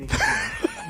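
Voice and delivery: high, loud laugh; real laugh; burst of laughter. Real, not acted. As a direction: crack up mid-story. Laughing mid-story.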